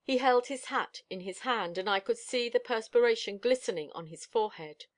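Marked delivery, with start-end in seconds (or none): none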